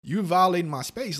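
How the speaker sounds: a touch of firm pushback, slowed down; firm retold warning. Chappelle pushing back on a woman recording.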